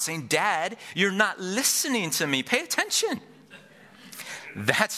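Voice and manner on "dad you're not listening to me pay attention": put-on high-pitched little kid crying out to a parent; frustrated child. Toddler to distracted dad after work, frustrated cry. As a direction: imitate a frustrated toddler, climbing to a high squeal. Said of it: "a little frustrated voice crying out".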